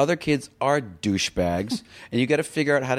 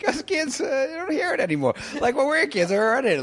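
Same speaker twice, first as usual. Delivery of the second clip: high voice after a laughing fit; helpless amusement. Real, not acted. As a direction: squeeze the words out high through amusement. Talking through a laughing fit, pitch way up.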